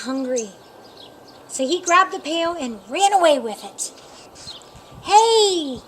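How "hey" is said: loud startled keeper shout; a keeper's sudden loud shout. A narrator's reading. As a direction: yell it to stop the thief. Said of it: "shouted the keeper".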